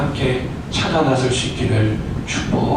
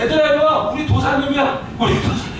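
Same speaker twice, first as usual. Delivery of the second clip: put-on calling kids over; 9 semitones higher than usual. Boy calls friends over, laughing.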